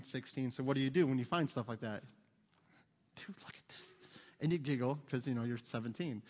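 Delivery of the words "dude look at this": put-on hushed, conspiratorial teen whisper; very soft, voiceless aside, imitating a teenager; furtive whisper. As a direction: whisper it secretly to a buddy. Teen furtively showing friend a find.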